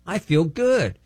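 high, wondering voice of a man set free; amazed relief. Retold or imitated. Divorced minister marvelling after the deliverance, high and amazed. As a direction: marvel at feeling suddenly lighter. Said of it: "He's like".

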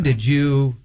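ordinary delivery

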